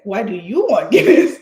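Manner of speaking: loud, swooping mock disbelief; incredulous question. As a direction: imitate a baffled store clerk, voice leaping up and down. Store guy's surprised question retold.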